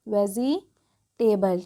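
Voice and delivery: Vegetable very slow, split "ve-zee... te-bal"; low, drawn-out vegetable with a gap in the middle; broken into parts. Shown as a demonstration. Teacher answering how to read it, vegetable in slow halves. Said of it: "क्या पढ़ेंगे".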